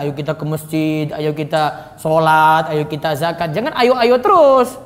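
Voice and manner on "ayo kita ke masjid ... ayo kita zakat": low, monotonous coaxing; mild exhortation. Shown as a demonstration. Teacher mimics a preacher who only gives nice advice, mild coaxing. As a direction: keep inviting in the same low tone. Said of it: "yang baik-baik terus disampaikan".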